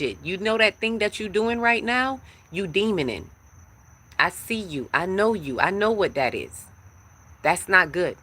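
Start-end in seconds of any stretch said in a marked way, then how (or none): none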